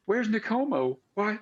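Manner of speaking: put-on high, scared little girl; small high voice, anxious questions. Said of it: "it's still kind of a little girl voice".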